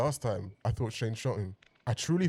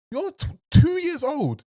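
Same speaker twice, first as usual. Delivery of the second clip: high, slow disbelieving cry; incredulous; 6 dB louder, slower than usual. Real, not acted. Yelling at the show about a two-year-old, high and slow. Exasperated rant at TV character.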